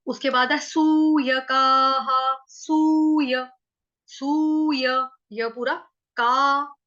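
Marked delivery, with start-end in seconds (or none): 0.1-5.1 सूय drawn out very slowly and a little louder; very slow syllable demo. Shown as a demonstration. Teacher breaking the word down, very slow. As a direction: stretch सूयकाः syllable by syllable. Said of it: "विसर्ग का उच्चारण हा".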